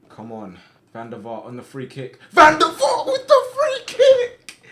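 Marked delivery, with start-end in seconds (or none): 2.3-4.6 extremely high, loud, stretched-out goal scream; ecstatic scream. Real, not acted. Player sees Van der Vaart score a free kick, ecstatic scream. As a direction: shriek the goal call in pure joy.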